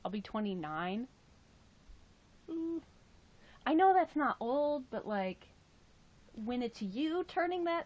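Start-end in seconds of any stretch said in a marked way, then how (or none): none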